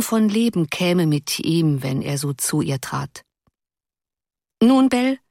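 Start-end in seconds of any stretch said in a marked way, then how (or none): 4.6-5.2 bright, raised, cheerful greeting. A narrator's reading. Husband cheerfully greeting his ailing wife, light and bright. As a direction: lift the voice brightly, in good spirits. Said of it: "sagte er heiter".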